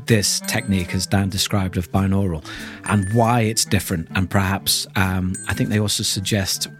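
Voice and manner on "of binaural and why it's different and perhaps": ordinary talk, nothing marked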